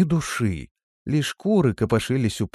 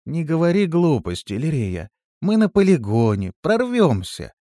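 raised, decisive tone; firm, resolute; 7 semitones higher, 3 dB louder than usual. A narrator's reading. Листи rallying her frightened friend, firm and resolute. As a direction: cut off the doubts with confidence. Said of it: "ответила Листи твёрдо и решительно".

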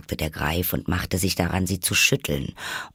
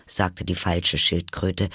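usual speaking voice

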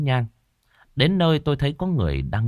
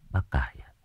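whispered prayer at grave; 5 semitones lower than usual. Acted. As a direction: murmur gently over the grave. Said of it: "Tôi thì thầm". (thì thầm khấn trước mộ)

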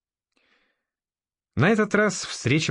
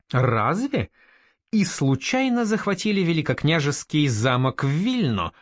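higher, sceptical questioning; open distrust; 5 semitones higher than usual. A narrator's reading. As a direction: question it with clear disbelief. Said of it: "с явным недоверием". (с явным недоверием)

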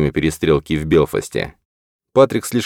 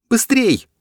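high urgent shout. A narrator's reading. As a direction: yell it back down. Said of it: "крикнула она фотографу".

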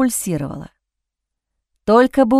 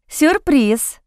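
mocking surprise, pitched higher; smirking; 5 semitones higher than usual. A narrator's reading. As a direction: toss it off with a triumphant smirk. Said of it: "усмехнулась Джейн". (насмешливо, с ухмылкой, выше)

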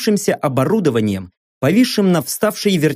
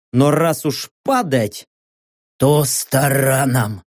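slow, lower machine voice; metallic Kappa; 3 semitones lower, slower than usual. A narrator's reading. Kappa finishing the narrator's thought, metallic imitation. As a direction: lower the voice into a metallic copy of the hero. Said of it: "металлически звякнул Каппа".

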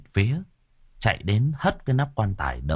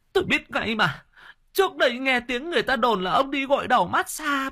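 much higher, accusing shrill rant; furious wife; 13 semitones higher than usual. A narrator's reading. Wife accusing her cheating husband, furious and much higher. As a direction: point the finger and let it all out. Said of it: "Bà sững cổ, chỉ mặt lão đồng".

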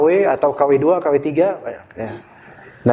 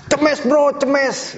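higher, slow street-player call; village badminton slang; 9 semitones higher, much slower than usual. Teacher imitating kampung badminton players, slow and high. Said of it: "Bahasa Tarkam itu".

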